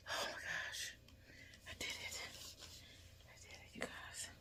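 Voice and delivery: real breath-only whisper of relief; stunned whisper. Real, not acted. Telling viewers the injection is done, whispering in disbelief. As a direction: whisper it, overwhelmed at having managed it.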